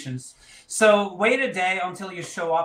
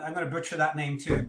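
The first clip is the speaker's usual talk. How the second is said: ordinary delivery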